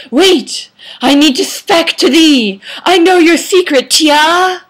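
loud, high theatrical plea; hammed-up urgent cry; melodramatic calling out. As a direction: declaim it across the hallway. Vampire boy calls after her.

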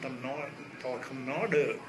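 usual speaking voice

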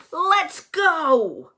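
storybook battle cry, not full volume; shouting. A narrator's reading. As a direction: call the troop to action. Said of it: "he shouts".

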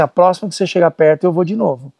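ordinary delivery

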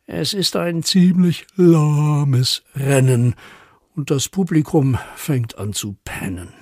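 deliberate verse reading, sinking toward sleepy; poem recital. A narrator's reading. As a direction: draw out the dull race, then wind down. Recites snail race poem.

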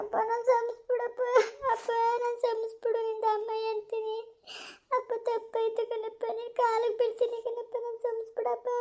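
high, soft, slow sobbing; crying plea. Acted. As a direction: weep while begging forgiveness. Daughter begs forgiveness crying.

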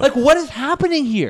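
high, exaggerated disbelief; incredulous. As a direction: ask it like you truly can't believe it. Mock bewildered question about construction.

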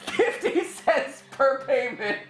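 slow sales pitch cracking up; laughing pitchman. Pretend. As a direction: sell it while giggling. Mock infomercial breaking into laughs.

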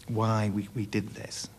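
ordinary talk, nothing marked

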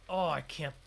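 ordinary delivery

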